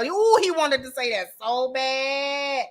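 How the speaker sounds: slow, drawn-out relish; long, lingering emphasis; savoring it. Real, not acted. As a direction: stretch the words out with glee. Savoring what he held back.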